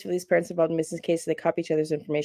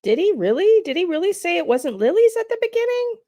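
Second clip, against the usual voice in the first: high, louder, incredulous question; surprised questioning; 12 semitones higher, 4 dB louder than usual. Real, not acted. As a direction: blurt it out in real surprise, pitched high. Reacting to a surprising detail just played.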